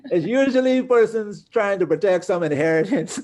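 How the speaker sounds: talking through laughter, high and loud; real laughing. Real, not acted. Lawyer joking about relatives guarding inheritance, laughing. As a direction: let the laugh carry the words upward.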